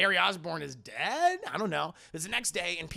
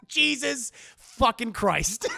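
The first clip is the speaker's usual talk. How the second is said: laughing; 7 semitones higher, 4 dB louder, much slower than usual. Real, not acted. Laughing at the movie.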